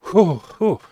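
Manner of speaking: two long, loud exhales; deep breath out. Retold or imitated. Bracing himself before hearing hard news, loud exhales. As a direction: blow the air out hard, twice. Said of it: "take a big, deep breath".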